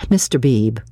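ordinary delivery, nothing marked

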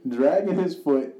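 half-laughing disbelief, pushed up high; incredulous. Retold or imitated. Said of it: "I'm like".

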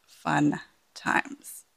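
ordinary talk

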